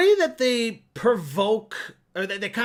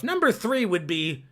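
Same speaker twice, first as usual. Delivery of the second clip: ordinary talk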